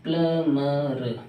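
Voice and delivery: प्लमर very slow, pla-ma-r; silent-b plumber. Shown as a demonstration. Teacher drills learners, slow split प्लमर. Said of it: "इसका प्लम्बर नहीं".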